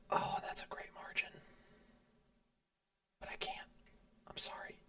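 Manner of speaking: very soft, near-whispered regret; hushed reluctance. Real, not acted. Player reluctantly turning down Denmark's deal, hushed and regretful. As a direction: murmur it to yourself, almost no voice. Mulling a tempting deal, then declining.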